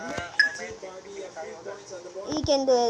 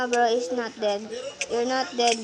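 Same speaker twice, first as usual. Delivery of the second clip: usual speaking voice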